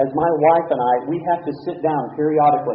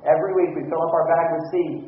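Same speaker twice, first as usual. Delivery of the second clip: usual speaking voice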